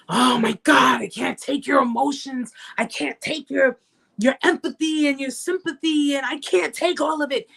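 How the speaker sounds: high-pitched, mock-exasperated complaint; hammed-up irritation, pitched well up; annoyed. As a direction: imitate someone fed up with all the feelings. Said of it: "they get annoyed by that stuff".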